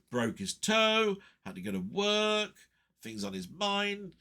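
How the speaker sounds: high, held note ending each item; list intonation. Retold or imitated. Listing friend's three lines of woe.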